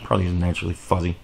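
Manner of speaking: low, soft, rapid aside; quiet self-deprecating afterthought; resigned mumble. Real, not acted. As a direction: answer yourself under your breath, quickly. Answering own question, resigned aside.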